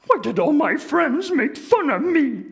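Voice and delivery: high, loud hurt puppet voice; sad Grover voice. Acted. Grover walking home alone, hurt high puppet voice. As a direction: ask it to yourself, wounded. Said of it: "letting me do the voices".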